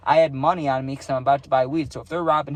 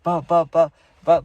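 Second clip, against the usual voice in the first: quick louder buh buh buh like hooves on dirt; imitated hoofbeats; 3 semitones higher, 7 dB louder than usual. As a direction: drum out galloping feet with your mouth. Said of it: "I'm guessing that's what it sounds like".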